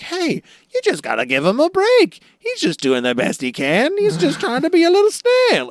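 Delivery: hammed-up nice talk in a very high, loud voice; Buggy. Buggy defends a scared little snail from his crew, exaggeratedly nice. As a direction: plead sweetly in a squeaky put-on voice. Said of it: "Buggy talks really nice".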